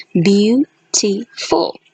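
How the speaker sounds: slow, syllables pulled apart: "Beautiful" as beau-ti-ful; syllable-by-syllable demo. Shown as a demonstration. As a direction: stretch "Beautiful" into its three syllables, slowly. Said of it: "It has three syllables".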